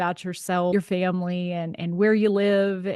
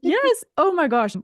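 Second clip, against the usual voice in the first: much higher and louder, gushing; excited exclamation; 12 semitones higher, 6 dB louder than usual. Real, not acted. Host laughing along with her guest, excitedly high and loud. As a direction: burst out with delight.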